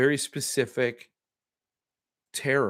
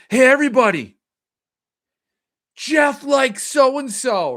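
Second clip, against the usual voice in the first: loud, high imitation of a kid; tattling announcement; 10 semitones higher, 7 dB louder than usual. Kid telling the class who likes whom, tattling announcement. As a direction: call it out to the whole room, drawn out.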